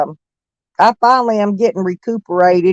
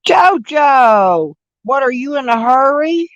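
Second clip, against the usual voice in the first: high, bright sing-song welcome; excited greeting; 7 semitones higher than usual. Real, not acted. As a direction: call out to a friend warmly. Greeting friend joining the stream.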